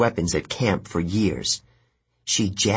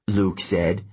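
ordinary delivery, nothing marked

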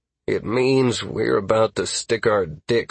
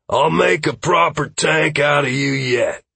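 character voice; 6 semitones higher, 4 dB louder than usual. Acted. Trey voiced, higher timbre.

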